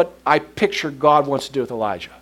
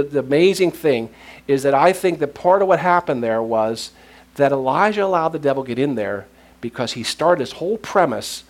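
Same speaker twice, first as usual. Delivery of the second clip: ordinary talk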